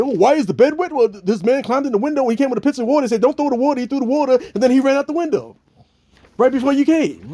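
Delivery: put-on high, eager kid's voice; young Bill's excuse. Little Bill fibbing to his father, high kid voice. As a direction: play the lying little boy, high. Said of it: "he'd be like".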